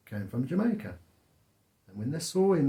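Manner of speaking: normal talking voice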